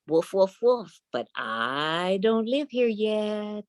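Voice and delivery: high, slow little dog voice; sly little dog. Acted. Little dog answers the talking gate, high and slow. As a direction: say it slowly like a clever puppy.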